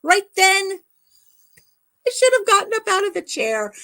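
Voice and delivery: hammed-up high cry of regret; high rueful exclamation. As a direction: pitch jumps way up in regret. Regretful story peak.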